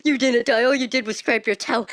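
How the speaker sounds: imitation of a dismissive brother; mocking laughing brother. Laughing brother to injured sister, mocking imitation. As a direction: mimic a teasing brother. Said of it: "my brother's laughing".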